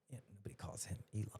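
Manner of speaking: fast unvoiced whisper; quick conspiratorial whisper. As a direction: lean in and whisper it as a secret. Aside about Musk's name.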